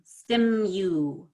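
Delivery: "Stimu" said as stim-you; Y after M. Shown as a demonstration. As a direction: draw out Stimu with a clear y glide. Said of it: "little Y sound in there after the M".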